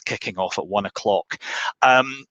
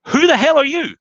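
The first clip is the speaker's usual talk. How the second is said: high, loud indignant yell; husband finding a naked man in his bathroom; outraged husband; 4 dB louder than usual. Acted. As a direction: bellow at the intruder, much higher. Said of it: "the husband says".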